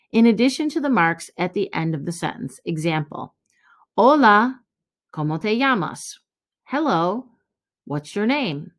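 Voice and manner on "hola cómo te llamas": Hola called out bright as an exclamation, then ¿cómo te llamas? asked as a question; cheerful Spanish Hola, then ask ¿cómo te llamas?; Spanish greeting. Shown as a demonstration. Teacher modelling the Spanish greeting for the class. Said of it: "hola is like an exclamation".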